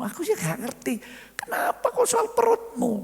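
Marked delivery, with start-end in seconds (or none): 1.3-2.9 much higher, louder baffled question; surprised. Acted. Wife asks husband why his stomach, high and baffled. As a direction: squeal it in disbelief.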